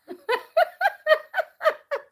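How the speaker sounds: high-pitched giggling burst; high giggle. Real, not acted. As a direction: giggle helplessly. Laughing after asking viewers.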